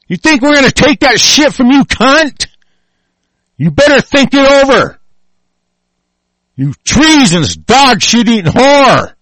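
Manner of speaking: real fury, yelling accusations; furious ranting. Real, not acted. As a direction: scream threats at your enemies. Sustained furious tirade at enemies.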